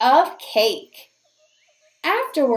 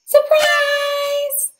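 surprise shout; 12 semitones higher than usual. Acted. Party animals yelling surprise at the squirrel.

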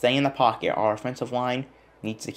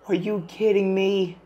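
higher, slow whine; stretched disbelief; 9 semitones higher than usual. Real, not acted. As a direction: drag out the disbelief. Disbelief at the play.

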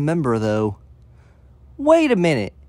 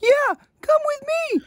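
put-on high girly voice; 14 semitones higher than usual. Amy voice.

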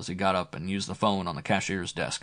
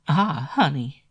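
high, sultry sigh of pleasure; sexy voice; 7 semitones higher, 5 dB louder, slower than usual. Acted. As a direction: melt into it. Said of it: "Flo's sexy voice".

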